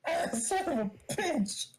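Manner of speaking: slow, high-pitched pained cursing; mock pain. Punched character cursing at the attacker, slow mock pain. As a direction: drag the curse out high, as if punched.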